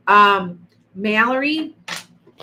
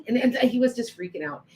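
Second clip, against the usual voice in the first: ordinary talk, nothing marked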